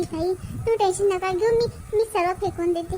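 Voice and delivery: usual speaking voice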